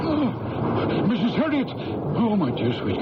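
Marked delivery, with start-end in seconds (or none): 0.1-1.7 high, startled cry of her name; shocked gasp. Acted. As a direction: gasp it out, horrified by what she said. Priest shocked by prayer for death.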